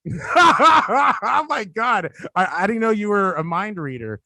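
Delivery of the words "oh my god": drawn-out laughing "oh my God". Real, not acted. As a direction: laugh through it, high and slow. Reacting to surprise impression offer.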